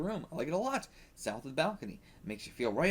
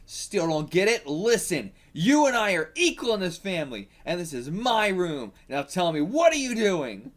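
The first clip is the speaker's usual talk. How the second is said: firm confrontation; 4 semitones higher, 6 dB louder than usual. Acted. Karu confronting her stepsister in her room, firm. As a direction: lay down the law to her.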